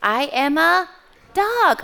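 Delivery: high, loud storybook dog; dog's proclamation. Acted. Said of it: "And the dog says".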